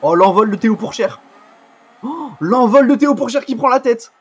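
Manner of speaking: loud, high, building commentator's cry; excited race commentary. Real, not acted. Gamer sees Théo Pourchère take the lead, thrilled shout. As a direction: shout the overtake, rising on the repeat. His driver takes the lead.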